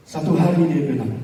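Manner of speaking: usual speaking voice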